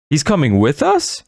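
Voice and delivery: high, alarmed question; startled high cry; surprised cry. A narrator's reading. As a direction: blurt it out in disbelief. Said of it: "I cried".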